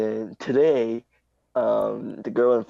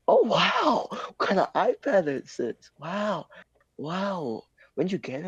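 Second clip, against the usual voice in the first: high, drawn-out mock amazement; gushing impressed classmate; 8 semitones higher, slower than usual. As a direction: imitate a classmate cooing over gadgets. Retelling an impressed classmate's questions.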